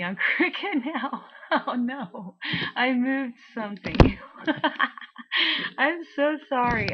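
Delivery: high, loud laughing yelp; startled laughing. Real, not acted. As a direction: caught off guard, laughing it off. Moved something, laughing in pain.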